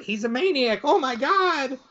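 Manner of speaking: high-pitched mock alarm; mock panic. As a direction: cry out as the terrified driver, playfully. Old man sees truck coming at him.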